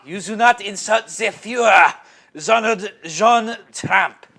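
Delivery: loud mock German accent, name drawn out slowly; hammed-up German proclamation. Co-host repeats the article's warning, mock German accent. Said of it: "when you do some of the German accents".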